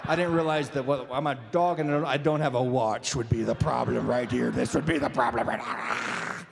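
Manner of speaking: low talking-dog voice; mock-contrite dog in a low register; apologetic dog. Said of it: "the dog's down there going".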